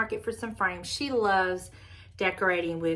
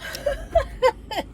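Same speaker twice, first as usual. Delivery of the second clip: burst of laughter; 5 dB louder than usual. Real, not acted. Laughing at thrift luck.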